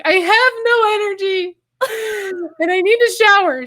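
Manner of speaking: mock sob on the phone; imitated weeping. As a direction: replay yourself crying to mom. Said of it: "I was like".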